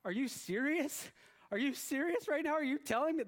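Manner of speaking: can't believe what he hears; incredulous. Retold or imitated. Fan hearing the score, a high incredulous outcry. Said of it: "what comes out of my mouth is".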